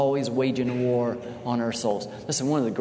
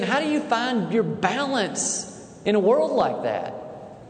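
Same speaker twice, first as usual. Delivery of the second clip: slow, higher pleading question; voicing the psalmist; 6 semitones higher, slower than usual. Preacher voicing the psalmist's desperate question, slow and pleading. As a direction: ask it like the shaken psalmist.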